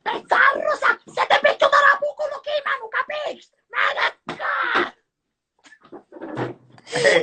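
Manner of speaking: high, shrill Sicilian woman quarrelling; Sicilian accent. Acted. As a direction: yell like a Sicilian woman in a fight. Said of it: "Mima una donna che litiga con un'altra donna, però lo voglio sentire in siciliano".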